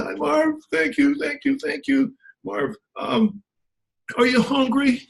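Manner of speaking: high, gushing voice of a thankful wife; imitating grateful wife. A grateful wife thanking Marv in a high gushing voice. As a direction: play the wife, voice lifted high with gratitude.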